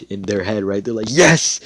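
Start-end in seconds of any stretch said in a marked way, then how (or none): 1.1-1.6 high, loud burst of glee; excited mock cheer; freaking out. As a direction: celebrate like a thrilled parent. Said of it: "They're, like, freaking out".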